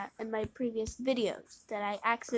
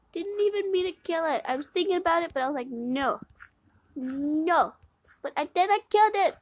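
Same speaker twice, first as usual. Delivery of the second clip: higher, louder upset rant; upset raised voice; 5 semitones higher, 7 dB louder than usual. Real, not acted. Player ranting about her accidental kill. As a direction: vent the frustration loudly.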